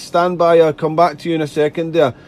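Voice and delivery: ordinary talk, nothing marked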